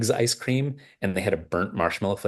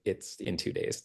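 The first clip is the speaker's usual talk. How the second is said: whispered deadpan; 10 dB quieter than usual. Retold or imitated. Wife's flat correction.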